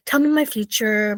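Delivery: ordinary talk, nothing marked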